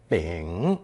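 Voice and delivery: bing dipped low, then lifted at the end; third tone. Shown as a demonstration. Teacher repeating the new character, bing in third tone. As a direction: say bing slowly with a falling-rising tone. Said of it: "Pronounced third tone".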